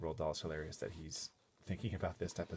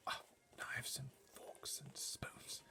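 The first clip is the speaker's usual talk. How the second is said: slow, higher whispered mutter; 7 semitones higher, 9 dB quieter, slower than usual. A narrator's reading. Butler worrying about cutlery, muttering. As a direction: mutter it to yourself. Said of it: "was heard to mutter".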